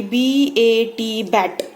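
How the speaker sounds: slow letter-by-letter बी ए टी, then बैट; drawn-out demo of spelling aloud. Shown as a demonstration. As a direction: spell out बी ए टी as separate names before बैट. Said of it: "इस तरह से नहीं बच्चों को reading सिखाने की".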